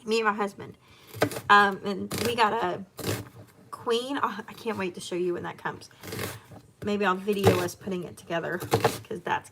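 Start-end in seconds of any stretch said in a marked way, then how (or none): none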